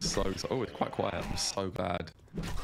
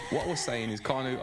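usual speaking voice

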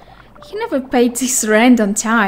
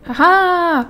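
loud gloating haha; evil laugh; 5 semitones higher, 4 dB louder than usual. Pretend. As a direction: cackle like a villain.

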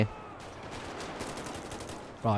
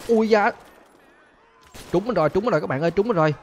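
high, louder cry of being hit; hit and yelping; 7 semitones higher, 4 dB louder than usual. Real, not acted. Telling viewers he got shot, high alarmed cry. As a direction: yelp as the bullet lands.

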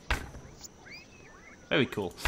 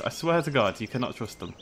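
usual speaking voice

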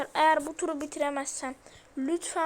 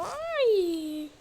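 startled yelp; 5 semitones higher, 4 dB louder than usual. Real, not acted. Startled by Granny appearing.

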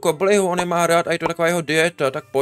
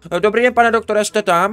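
louder, higher call into the phone; 5 semitones higher, 7 dB louder than usual. Acted. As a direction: shout a hello down the line. Calling the doctor on phone. (hlasitě do telefonu)

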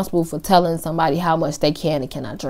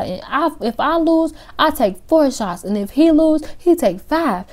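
put-on drunk girl; 9 semitones higher than usual. Drunk girl voiced in story.

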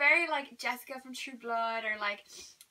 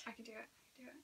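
hushed self encouragement; whispered pep talk; 3 semitones lower, 22 dB quieter, much faster than usual. Real, not acted. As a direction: whisper it to yourself. Psyching herself up.

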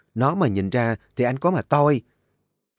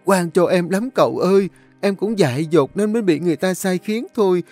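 high, whining, tearful plea; cornered girl begging the young master, wailing; wailing loudly; 8 semitones higher, 3 dB louder than usual. A narrator's reading. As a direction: wail and blame the other man. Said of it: "nó liền bù lu bù loa".

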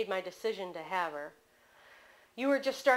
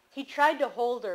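usual speaking voice

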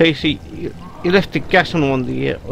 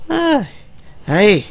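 put-on higher-pitched, playful Irish accent; 4 semitones higher than usual. Player showing off the accent they're sticking with, Irish accent. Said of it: "nothing like a bit of Irish".

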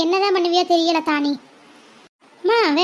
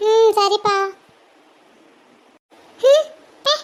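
high, mocking childish voice; a kid pulling a face at her mother; sulky child; 4 semitones higher, 3 dB louder, slower than usual. Child sulks, sticks tongue out.

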